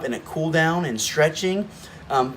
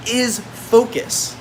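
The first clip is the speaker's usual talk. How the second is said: drawn out, very slow reveal; slow emphasis; 6 semitones higher, much slower than usual. Real, not acted. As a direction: linger on the key word.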